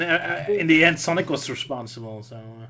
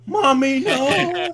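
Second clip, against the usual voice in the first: put-on shrill panicked child voice, much higher; scared high-pitched cry; 14 semitones higher, 4 dB louder, much slower than usual. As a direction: cry out for mommy in terror. Robotnik scared, calling his mom.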